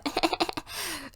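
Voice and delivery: very high put-on giggle; Sophie. Vlogger mimicking a contestant's laugh, high mock giggle. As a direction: imitate her laugh in a high pitch. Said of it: "elle rigole comme ça".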